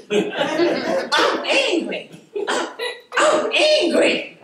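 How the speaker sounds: put-on high, slow little-child voice saying he's angry; mock toddler insisting he's angry; imitating a three-year-old. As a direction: drag it out like a pouting toddler. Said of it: "he said".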